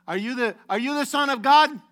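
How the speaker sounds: loud, high-pitched interrogation; demanding questioner. Acted. Preacher acting out Yeshua's questioner, loud and demanding. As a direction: fire it out as an urgent accusation.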